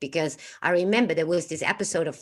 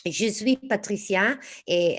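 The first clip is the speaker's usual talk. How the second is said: put-on French accent; 3 semitones higher, slower than usual. Introducing herself on the channel, French accent. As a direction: introduce yourself slowly in French. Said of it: "stick with the, uh, French, uh, accent".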